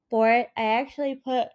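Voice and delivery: ordinary talk, nothing marked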